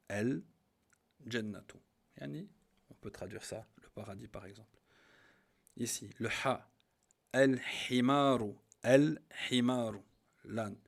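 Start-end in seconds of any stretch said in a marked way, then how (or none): 0.1-1.8 very slow "Al-Jannatu", pausing after a clear L; lam pronounced. Shown as a demonstration. Teacher repeating the correct form, drawn out with L sounded. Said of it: "on dit". 7.3-10.0 loud, slow "Al-Himaru", L clearly sounded; lam pronounced. Shown as a demonstration. Teacher giving the ha example, L sounded loudly and slowly. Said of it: "on prononce le lem".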